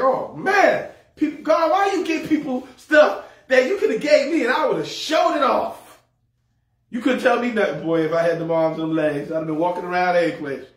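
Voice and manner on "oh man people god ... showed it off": higher, laughing mock outcry; exclaiming envy. Preacher retelling his envy of wife's legs, laughing exclamation. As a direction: cry out to God, half laughing. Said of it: "I was like".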